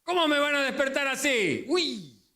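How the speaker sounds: high, irritated shout; mock angry yell. Jesus imagined yelling at the disciples who woke him. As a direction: snap back as if rudely woken. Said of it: "¿Habrá gritado Jesús en ese momento?".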